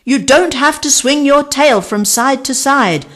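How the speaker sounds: high, loud arguing bird. A narrator's reading. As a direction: argue back, cross and shrill-free. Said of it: "argued Archie".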